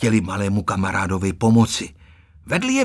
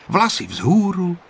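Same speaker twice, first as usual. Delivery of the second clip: plain reading voice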